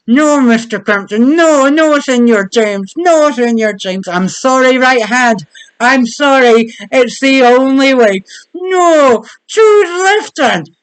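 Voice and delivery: a dramatic right hand pleading in a bad Spanish accent. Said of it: "Why does my right hand have a Spanish accent?".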